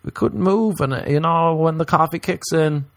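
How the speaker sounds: squeaky high excuse; high mock caller voice. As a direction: plead it up high, a bit sheepish. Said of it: "You'll be like".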